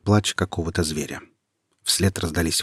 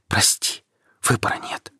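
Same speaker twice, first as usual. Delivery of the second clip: whisper; 3 semitones higher than usual. A narrator's reading. Nika whispers farewell to Quentin before stabbing herself. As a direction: whisper goodbye as she decides to die. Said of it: "прошептала она".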